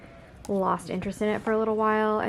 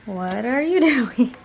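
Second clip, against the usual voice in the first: laughing question; 2 semitones higher, 4 dB louder than usual. Real, not acted. Repeats it, laughing at the cat.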